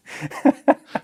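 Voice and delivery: high burst of laughter. Real, not acted. Laughing at own story.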